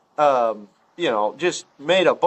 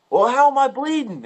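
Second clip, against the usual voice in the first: higher-pitched, puzzled retold question; mock bewilderment; 8 semitones higher than usual. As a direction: imitate a baffled injured hunter. Voicing bewildered bleeding shooter.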